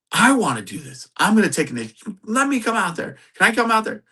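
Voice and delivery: put-on high, childlike pleading; mock eager. Peter begs Yeshua to let him come out, mock eager. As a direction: beg excitedly, pitch climbing. Peter begging to walk on water.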